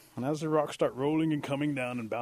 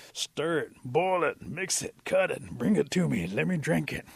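brisk list of raised-voice commands; urgent instructions; 5 semitones higher, 6 dB louder, slower than usual. Acted. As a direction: fire off each order separately. Possum orders the remedy made.

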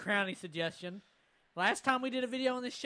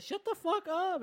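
higher, half-shouted quote of the student; mock yell; 12 semitones higher, 4 dB louder than usual. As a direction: snap it back as the girl. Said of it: "she said".

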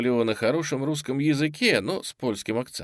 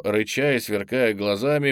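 usual speaking voice

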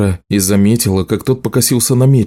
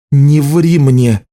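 slow, forceful warning, pitched up; angry growl; 6 semitones higher, 4 dB louder, much slower than usual. A narrator's reading. Healer warns the lying juggler, slow angry demand. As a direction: stretch each word out in anger. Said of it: "прорычала Лиша".

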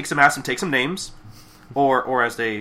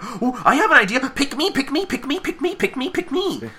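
high-pitched eager pleading; Pinky; 11 semitones higher than usual. Retold or imitated. Pinky begging Twilight to pick her, squeaky excited voice. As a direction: squeal a rapid chant like a keen pupil. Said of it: "And Pinky's like".

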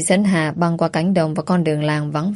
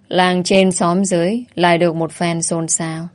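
usual speaking voice

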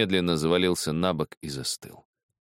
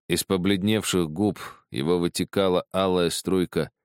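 ordinary delivery, nothing marked

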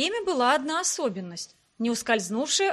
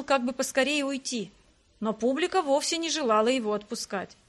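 plain reading voice

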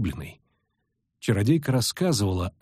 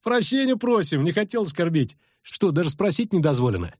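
mock politeness; 6 semitones higher than usual. A narrator's reading. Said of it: "преувеличенно почтительно поклонился".

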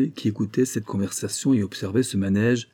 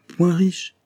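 interruption incrédule; 9 semitones higher, 4 dB louder than usual. Acted. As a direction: Point riches lancé bien plus haut. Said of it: "interrompit le duc". (le duc coupe le major, incrédule)

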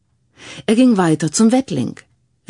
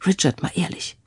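quiet question; 7 dB quieter than usual. A narrator's reading. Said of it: "leise über das Säuseln".

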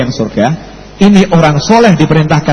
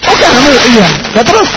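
surprised; 6 semitones higher than usual. Acted. Acted dialogue, surprised cleric.

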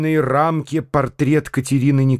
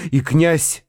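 ordinary delivery, nothing marked

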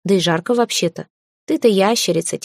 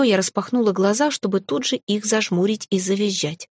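usual speaking voice

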